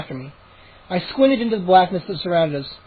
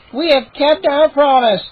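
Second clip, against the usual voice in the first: higher, slow, gleeful gnome voice; gleeful gnome announcing; 7 semitones higher, 4 dB louder than usual. Acted. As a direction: announce the prize like a giddy gnome. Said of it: "Chip declared, giggling".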